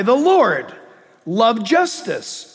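ordinary talk, nothing marked

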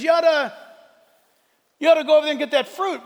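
the snake rushing her toward the fruit; tempting snake. Retold or imitated. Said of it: "and the snake says".

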